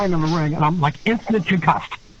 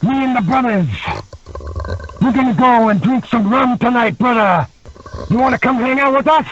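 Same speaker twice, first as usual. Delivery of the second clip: imitating Jimmy; 6 semitones higher than usual. Legend Jimmy inviting the young wrestler out for rum, hearty and higher. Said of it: "He's like".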